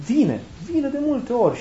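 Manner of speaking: high, insistent "Vine, vine"; emphatic answer. Real, not acted. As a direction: leap up in pitch to reassure.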